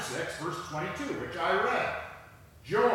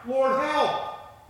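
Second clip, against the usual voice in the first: high, loud plea to God; cry for help; 7 semitones higher, 5 dB louder than usual. A narrator's reading. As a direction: cry out for rescue as if in trouble. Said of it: "they cried in their trouble".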